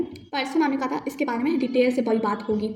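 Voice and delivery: normal talking voice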